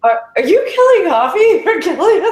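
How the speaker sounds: high, loud eager question; excited clerk. Retold or imitated. Clerk on the phone recognizing Kelly, high and eager. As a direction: ask it like a thrilled fan.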